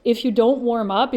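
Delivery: usual speaking voice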